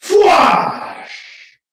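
sharp voiced blaster whoosh; blaster zap. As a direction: spit out a laser blast. Blaster shot sound effect.

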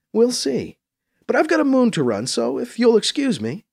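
high-pitched character voice, politely doubting; sceptical woman governor. Acted. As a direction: take your leave with cool scepticism.

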